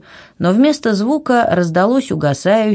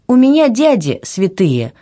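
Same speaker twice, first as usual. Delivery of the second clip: hot-tempered objection, raised pitch; heated retort; 6 semitones higher than usual. A narrator's reading. Anna objecting to the king about her uncles, heated. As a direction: fire back indignantly, voice lifted. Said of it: "в запальчивости возразила Анна".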